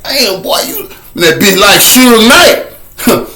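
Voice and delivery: lightly voiced impressed onlooker, dragged out slowly; imitating an onlooker. Onlooker marvels at him standing stiff, slow mock awe.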